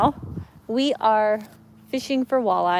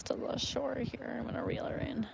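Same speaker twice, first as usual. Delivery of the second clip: whisper; 16 dB quieter than usual. Real, not acted. Quietly reeling in near shore.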